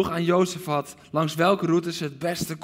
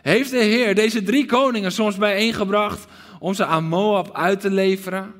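louder, higher outcry; cry out; 5 semitones higher, 6 dB louder than usual. Acted. Preacher voicing the king crying out again, loud. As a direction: protest loudly to the heavens. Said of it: "En dan roept hij ook nog uit".

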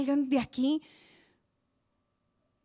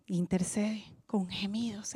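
hushed whisper of awe; 6 semitones lower, 6 dB quieter than usual. Real, not acted. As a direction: whisper it as a secret. Solemn point about groaning intercession.

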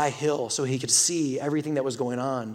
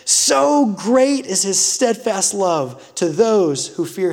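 fervent pulpit reading; preaching scripture; 5 semitones higher, 8 dB louder than usual. Acted. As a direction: proclaim it to the whole church. Scripture read at full force.

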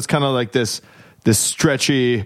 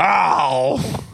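mock despairing oh; 6 semitones higher than usual. Worker's despairing sigh.

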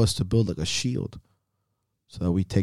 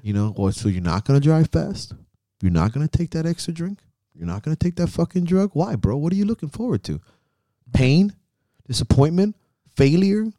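higher taunting jabs, rising to a sneer; mocking taunt; 6 semitones higher than usual. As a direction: needle a coward as a cocky bro. Mocking the reckless inner voice.

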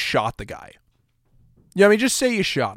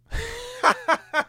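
squeaky, much higher burst of laughter; high-pitched laugh; 5 dB quieter than usual. Real, not acted. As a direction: cackle at your own joke. Laughs at his own jab.

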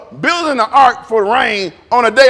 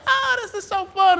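higher, gushing fake enthusiasm; mock thrill-seekers; 10 semitones higher, 4 dB quieter than usual. Mocking people who love the ride, high gush. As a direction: mimic excited riders, lightly mocking. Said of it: "people are like".

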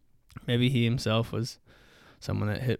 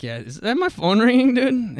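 fake excuse; 12 semitones higher, 6 dB louder than usual. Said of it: "I'm like".

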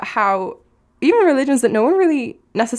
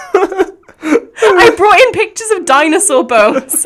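high, slow, teary laughter; laughing; 7 semitones higher, 7 dB louder, much slower than usual. Real, not acted. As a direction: laugh till it almost cries. Laughing-crying at the memory.

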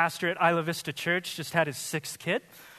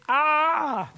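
mock yelp; 9 semitones higher, 5 dB louder than usual. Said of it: "story arc".

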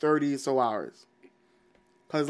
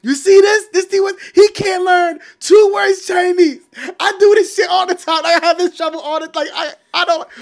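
high, loud, shouted exasperated voice; Jackie Chan impression; 9 dB louder than usual. Retelling the Rush Hour outtake, high shouting rant. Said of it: "And Jackie Chan was like".

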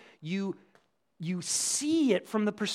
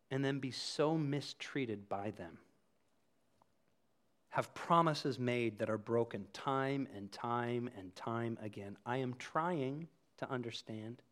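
low, measured, earnest prayer; confessional prayer; 6 semitones lower, 4 dB quieter than usual. Acted. Sinner asking God's forgiveness, low earnest prayer. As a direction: confess humbly to God, softly and unhurried.

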